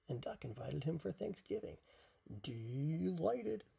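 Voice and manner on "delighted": loud, booming elephant; big storybook elephant voice. A narrator's reading. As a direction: boom it out with the first syllable stretched. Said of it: "boomed Elephant".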